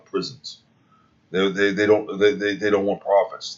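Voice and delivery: ordinary delivery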